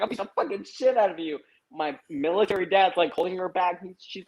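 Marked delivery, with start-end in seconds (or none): 0.0-1.4 high-pitched impression of mom's threat; imitated angry threat. Tiny mom squares up to the neighbor, mock fury. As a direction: imitate a small, fierce woman threatening.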